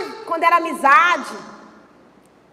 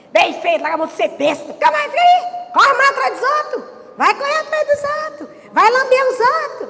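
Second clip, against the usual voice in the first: much higher, scolding mother, mock-harsh; bronca de mãe; 7 semitones higher than usual. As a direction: tell off the heartbroken kid, shrill with scorn. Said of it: "A minha mãe falava". (mãe dando bronca, debochada e aguda)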